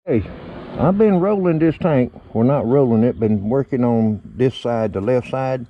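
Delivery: ordinary delivery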